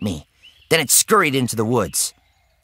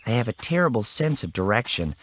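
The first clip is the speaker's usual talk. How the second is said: ordinary delivery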